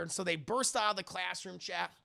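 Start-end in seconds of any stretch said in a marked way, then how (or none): none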